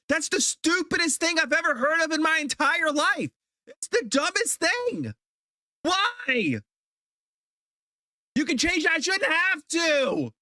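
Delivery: high-pitched, shouting exasperated rant; furious rant. Real, not acted. Streamer raging at OneDrive for deleting files, high furious shouting. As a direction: let the outrage boil over, voice climbing.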